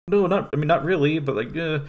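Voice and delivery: bashful high-pitched brush-off, trailing into a shrug; awkward denial. Retold or imitated. Takeshi brushing off Cunningham's question, bashful high denial.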